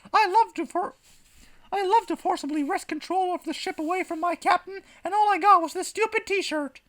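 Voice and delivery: very high-pitched t-shirt slogan; put-on slogan voice. Bellowing man's t-shirt slogan, silly high voice.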